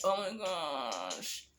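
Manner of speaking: slow, soft, dragged-out oh my gosh; mock dismay. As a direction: sigh it out like the chair is a mountain. Struggling onto high bar chair.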